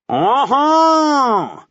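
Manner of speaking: high, loud, cocky announcement; brash high whoop of arrival. A narrator's reading. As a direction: announce your arrival to the whole bus. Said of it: "the wee shite announced".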